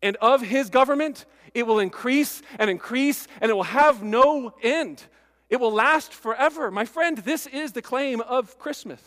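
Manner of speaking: loud, high pulpit proclamation; ringing sermon climax; full-force preaching. Acted. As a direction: proclaim it over the congregation.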